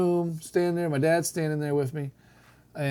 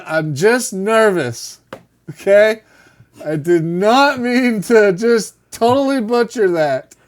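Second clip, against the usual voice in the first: nervous excuse; 4 semitones higher, 8 dB louder, slower than usual. Retold or imitated. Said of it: "And I said".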